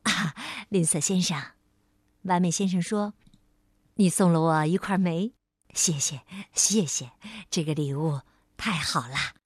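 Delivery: lightly voiced courteous gentleman; polite Mr. Perfect. A narrator's reading.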